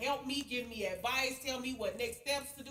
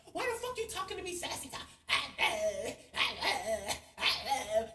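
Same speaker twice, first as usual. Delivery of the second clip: retold angry yell. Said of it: "I'm talking to him just like that".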